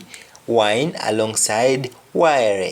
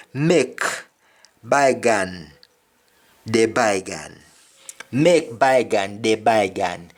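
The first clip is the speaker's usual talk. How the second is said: Jamaican Patois, phrase by phrase; measured Jamaican Patois demonstration; real Jamaican. Shown as a demonstration. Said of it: "in real Jamaican".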